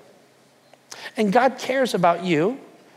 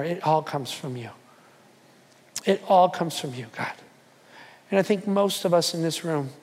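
prayer; 3 semitones lower, 3 dB quieter than usual. Acted. Pastor leading the congregation in prayer, hushed. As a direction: pray humbly and gently.

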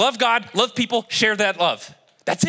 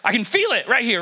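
ordinary talk, nothing marked

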